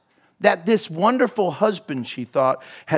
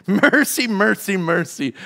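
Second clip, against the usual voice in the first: gentle mercy. Acted. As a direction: pour out mercy, softening each time. God says mercy, fading.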